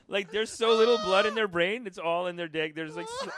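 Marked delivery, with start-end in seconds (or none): none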